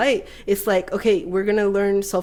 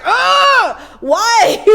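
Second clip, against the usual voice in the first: much higher, loud exasperated cry; exasperated wail; 12 semitones higher, 8 dB louder than usual. Retold or imitated. Retelling the fart cloud moment, exasperated wail. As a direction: throw the why out in frustration. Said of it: "I'm, like, suddenly like".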